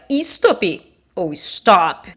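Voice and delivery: slow contrast of "stop" with stress on "top" versus on "s"; teacher compares where the shout lands in "stop", slow and stressed; stressed syllables contrasted. Shown as a demonstration. Teacher contrasting two stresses of stop, slowed down. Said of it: "se você fosse gritar a palavra stop".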